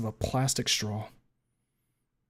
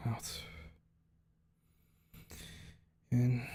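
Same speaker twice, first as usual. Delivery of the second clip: paced breathing; 6 dB quieter, much slower than usual. Acted. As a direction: breathe in and out audibly between the words. Paced breathing exercise to calm down.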